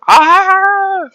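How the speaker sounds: high, loud held "Ah!"; mock scream. Painter voicing the screaming face on the figure, mock scream. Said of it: "looks like he's screaming".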